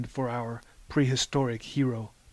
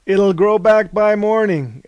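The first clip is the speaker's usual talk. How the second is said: loud group cheer; loud echo; 6 semitones higher, 9 dB louder than usual. A narrator's reading. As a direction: chant it back loudly. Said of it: "echoed Hesiod".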